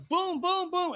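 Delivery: punchy booms climbing high and loud; rapid-fire impact noises. Retold or imitated. Storyteller acting out his brother's strikes, punchy booms. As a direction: hit each boom like a blow. Imitates a burst of blows.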